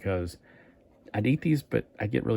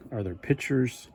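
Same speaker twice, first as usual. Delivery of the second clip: ordinary talk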